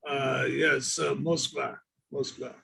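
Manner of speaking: a hint of a Russian accent; lightly put-on Russian accent. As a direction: answer like a Russian comrade. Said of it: "have we gone to Russia".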